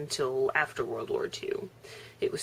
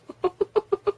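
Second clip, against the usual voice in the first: high laugh; 7 dB louder than usual. Real, not acted. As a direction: giggle up high. Laughing at own story.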